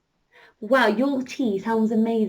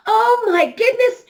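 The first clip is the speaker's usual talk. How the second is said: much higher, louder, thrilled exclaim; amazed excitement; 10 semitones higher, 7 dB louder than usual. A narrator's reading. Storyteller thrilled that Scarlett is flying.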